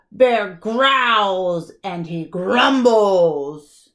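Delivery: usual speaking voice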